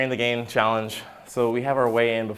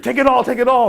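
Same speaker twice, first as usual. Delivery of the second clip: put-on higher, louder desperate calling after a kid; 12 dB louder than usual. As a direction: beg the kid to take all the candy. Chasing the last trick-or-treater.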